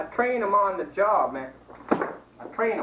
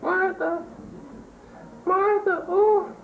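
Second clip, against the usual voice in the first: high calls; high yelling call; 11 semitones higher than usual. Acted. Mating call repeated.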